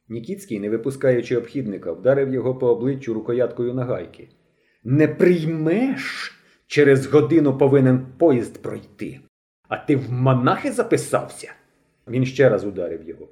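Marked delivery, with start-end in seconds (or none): 4.8-11.5 погрозливе глузування. A narrator's reading. Бандит б'є й глузує.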